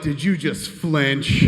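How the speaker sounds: loud, slow mock disbelief; imitating an affronted tough guy; incredulous challenge. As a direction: stretch the question out, challenging him. Acted boss confronting the flinch.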